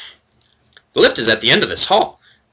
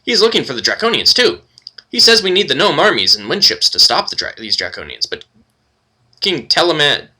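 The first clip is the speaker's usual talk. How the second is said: high, quick, chirpy kender voice; kender chatter; 3 semitones higher than usual. Acted. Tass the kender tells the party about gnomes, high and quick. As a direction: chatter away like an eager little kender.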